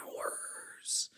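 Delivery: breathy whisper. Real, not acted. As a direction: whisper an aside. Whispered aside.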